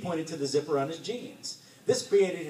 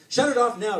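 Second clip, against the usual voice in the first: high, loud urgent yell; 9 semitones higher, 9 dB louder than usual. A narrator's reading. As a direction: yell to make it stop at once. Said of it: "yelled Sarah".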